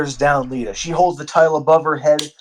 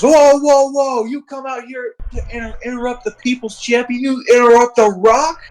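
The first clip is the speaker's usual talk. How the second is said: The Rock impression; 9 semitones higher than usual. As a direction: bellow it like a wrestler cutting off a rival. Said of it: "And The Rock says".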